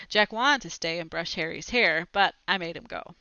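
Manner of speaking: ordinary delivery, nothing marked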